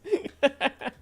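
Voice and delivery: high burst of laughter; real laugh. Real, not acted. Streamer amused by own teasing, high laugh. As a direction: laugh freely at your own joke.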